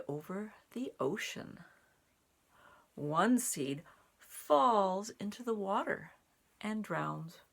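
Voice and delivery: measured storybook narration; reading to children. A narrator's reading. Reading the ocean page to children, steady storytime voice. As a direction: carry them gently over the ocean. Reads picture book aloud.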